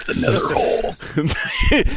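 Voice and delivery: slow, high, drawn-out band title; dramatic announcer voice. Pretend. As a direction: announce it like a spooky movie title. Names goth band.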